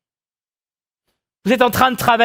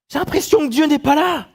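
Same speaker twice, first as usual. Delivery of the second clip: higher-pitched plaintive questioning; anguished complaint; 7 semitones higher than usual. Acted. Preacher voicing believers who feel abandoned, plaintive. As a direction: lament God's absence, voice lifted.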